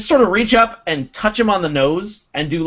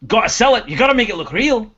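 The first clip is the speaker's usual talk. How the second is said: urging Durgan; 5 semitones higher, 5 dB louder, faster than usual. Acted. Acts Durgan urging a fake fight.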